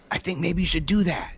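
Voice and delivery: a hint of an innocent kid giving advice; small boy's voice. Dad voicing son's innocent advice.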